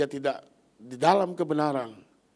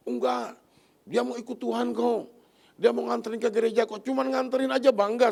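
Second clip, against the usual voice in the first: higher pitched, insistent voice of the friend; defensive young woman; 8 semitones higher, 3 dB louder than usual. Retold or imitated. Preacher imitating his friend brushing off his warning, higher and insistent.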